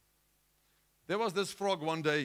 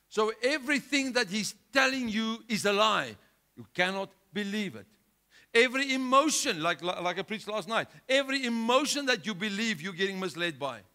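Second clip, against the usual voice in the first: loud, raised preacher's warning; full-force preaching; 5 semitones higher, 8 dB louder than usual. Acted. Preacher warning the church against Satan's lies, loudly. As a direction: drive the warning home.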